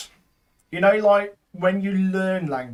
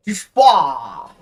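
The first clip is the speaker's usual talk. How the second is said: mock wail; 9 dB louder than usual. Punchline of cat joke.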